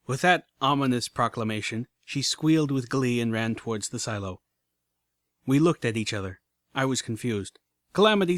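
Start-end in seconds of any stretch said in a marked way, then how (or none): none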